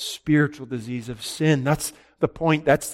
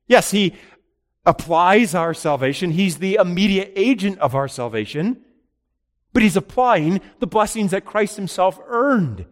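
higher and louder, steady preaching build; building proclamation; 6 semitones higher, 3 dB louder than usual. Acted. Preacher teaching congregation about salvation, higher and louder. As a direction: press the point with rising fervour.

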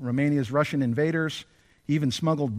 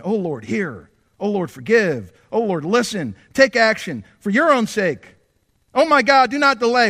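short clipped pleas, growing louder at the end; urgent staccato prayer; 5 semitones higher, 6 dB louder than usual. Acted. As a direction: fire off each plea, then build. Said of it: "urgent, fervent, staccato-like prayers".

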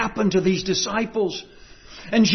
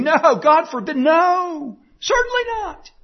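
rising emphatic no; 10 semitones higher than usual. Acted. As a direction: pitch climbing higher to a cry. Screaming no, rising pitch.

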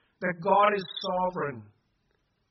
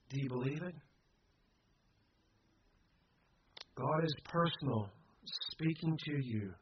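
quiet challenge; 2 semitones lower, 8 dB quieter than usual. Real, not acted. Preacher tells congregation God speaks personally, quietly. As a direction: let it sink to a hushed question and assurance.

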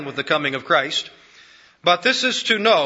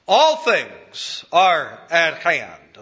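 slow, emphatic, with "All" hit hard; stress on ALL; 3 dB louder, much slower than usual. Shown as a demonstration. Preacher driving "All" home to his congregation, slow and emphatic. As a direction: lean on "All" and slow every word. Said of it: "It's very emphatic".

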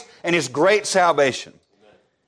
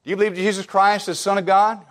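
ordinary talk

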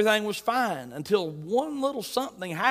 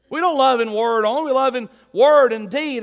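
louder preacher's declaration; preaching at full force; 4 semitones higher, 7 dB louder than usual. Real, not acted. Preacher to congregation, loud declaration. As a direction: proclaim it to the whole room.

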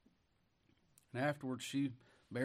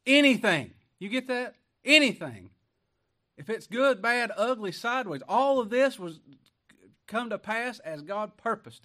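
much louder and higher, hammering "Anything"; full preaching shout; 7 semitones higher, 11 dB louder than usual. Real, not acted. Preacher proclaiming God's purpose to the church, at full force.

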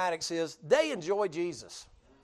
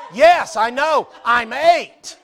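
put-on a boy insisting, pitched up and much louder; earnest little boy; 4 semitones higher, 11 dB louder, much slower than usual. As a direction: play the eight-year-old, loud and sure of himself.

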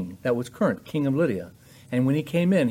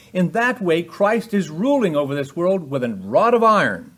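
higher and louder, pressing each phrase home; full-force preaching; 5 semitones higher, 6 dB louder than usual. Acted. Preacher driving repentance home to listeners, loud and raised. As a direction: preach it hard to the congregation.

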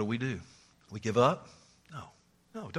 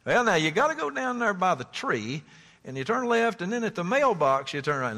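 higher, chatty country voice; folksy directions; 7 semitones higher, 4 dB louder than usual. Retold or imitated. Preacher voicing a country boy giving directions.

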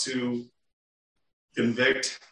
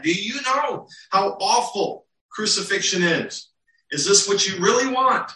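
much higher, insistent disbelief; incredulous Pilate; 6 semitones higher than usual. Retold or imitated. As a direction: plead with the crowd in disbelief. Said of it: "he's almost incredulous at this point".

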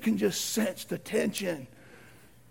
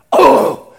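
very loud startled "oh"; acted burst of dismay; alarmed exclamation; 4 semitones higher, 14 dB louder than usual. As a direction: blurt out in comic panic. Household startled by preacher's arrival.

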